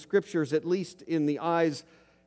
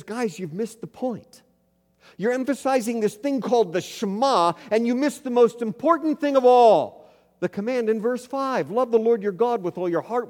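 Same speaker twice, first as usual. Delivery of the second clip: higher and louder, urgent rebuke; Jesus correcting them; 6 semitones higher, 5 dB louder than usual. Retold or imitated. Jesus telling the Pharisees they missed the point, urgent and loud. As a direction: set them straight with rising passion. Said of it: "But Jesus says".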